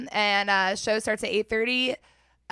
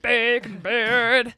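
slow announcer; 2 semitones higher, 5 dB louder, much slower than usual. Pretend.

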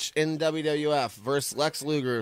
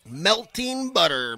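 drawn-out wrestler impression, pitched up; Macho Man; 6 semitones higher, slower than usual. Co-host quotes Macho Man over the match, wrestler impression. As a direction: stretch it out in a hint of Macho Man. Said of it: "Macho Man says".

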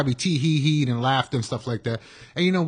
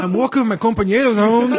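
put-on East LA Mexican accent, higher and louder; 8 semitones higher, 4 dB louder than usual. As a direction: talk like an LA homeboy, Mexican accent. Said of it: "and then it goes to".

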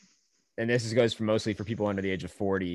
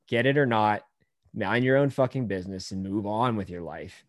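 ordinary talk, nothing marked

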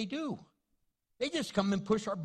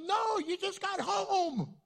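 higher, slower mock wife voice; wife's voice; 12 semitones higher, 4 dB louder, slower than usual. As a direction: lift the pitch to play the wife's breezy no. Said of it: "She goes".

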